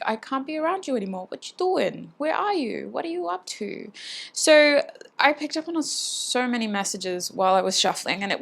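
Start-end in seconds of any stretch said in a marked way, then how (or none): 0.1-3.9 rising, pleading questions piling up; can't stay away, keep asking; clingy questioning. Pretend. Spirit's message voiced as needy questions to the viewer. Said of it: "it's more like".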